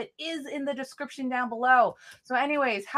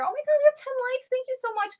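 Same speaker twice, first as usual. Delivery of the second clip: much higher, fast burst of delight; thrilled squeal; 12 semitones higher, faster than usual. Real, not acted. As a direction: gush over the new likes. Excited at ten likes.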